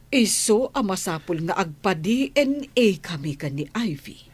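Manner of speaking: normal talking voice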